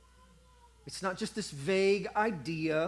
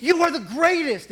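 high, loud cheer for a hero; crowd cheering; 9 semitones higher, 10 dB louder than usual. Retold or imitated. The crowd cheering Blondin on, loud and high. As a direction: shout it like an adoring crowd.

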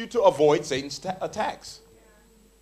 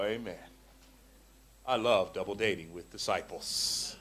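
lower, soft, slow sigh of delight; mock contentment; 9 semitones lower, 9 dB quieter than usual. Single touched by a sister's cookies, soft contentment. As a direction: melt over the cookies.

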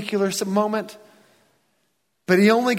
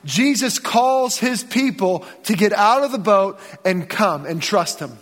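ordinary talk

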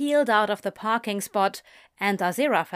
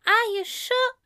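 high, fast, fussy angel voice; a prim, gentle angel, pitched up; Aziraphale voice. Acted.

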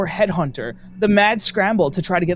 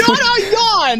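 high, loud, slow teasing; laughing denial; 14 semitones higher, 5 dB louder, slower than usual. Real, not acted. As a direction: drag it out, laughing.

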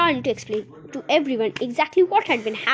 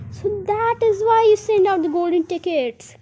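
put-on raised, louder boy's exclamation; excited cry; 9 semitones higher, 6 dB louder than usual. A narrator's reading. Charlie realises why the tickets were sent, crying out. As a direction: cry it out, high and loud. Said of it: "cried Charlie".

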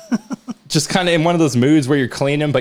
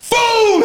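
much higher, loud explosive boom; loud mock explosion; imitated boom; 4 dB louder than usual. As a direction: shout the blast like a cartoon. Imitates disposal blast.

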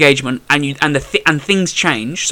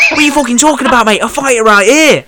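loud, high-pitched imitation of an outraged fighter; mock angry challenge; 7 semitones higher, 4 dB louder than usual. Beaten opponent squaring up in the ring, mock angry challenge. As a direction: shout it like a ludicrous threat.

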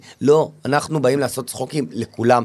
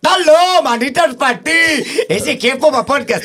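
high, loud, slow hammed-up French voice with laughter; a silly French caricature, laughing through it; mock French accent; 12 semitones higher, 3 dB louder, slower than usual. Comedian asked for a French impression, high hammed-up voice with a laugh. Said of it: "צרפתי, תן".

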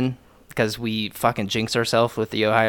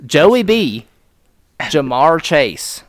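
loud, high roll call of heroes; proud shout-out; 4 semitones higher, 8 dB louder, slower than usual. Real, not acted. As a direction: hail each player like a thrilled fan. Fan hailing his team's players.

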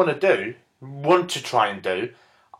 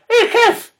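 hey pitched way up; high mock greeting; 4 dB louder than usual. Comedian greeting best mate Kev in pub, high mock hey. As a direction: call out to your mate high.

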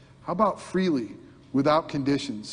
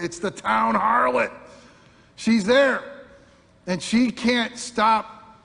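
higher and louder, building suspense; dramatic storytelling; 4 semitones higher, 4 dB louder than usual. Acted. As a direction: tell it like a gripping scene unfolding.

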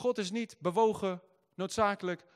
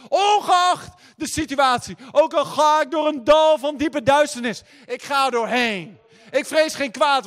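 rising shouted preacher's climax, much louder and higher; preaching at full force; 7 semitones higher, 16 dB louder than usual. Acted. Preacher rallies the congregation, shouting louder and higher. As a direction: build the sermon into a roaring crescendo.

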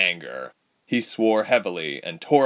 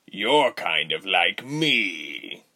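smug villain; much slower than usual. A narrator's reading. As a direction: say it slow and smug. Rubens voiced.

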